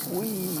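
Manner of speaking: soft, high-pitched whee; playful whee. Real, not acted. Driver turning the car around, playful whee.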